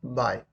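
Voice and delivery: बाय modelled low and soft; pronunciation demo. Shown as a demonstration. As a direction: say बाय quietly, like a spoken example. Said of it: "इसका pronunciation क्या निकल के आ रहा है".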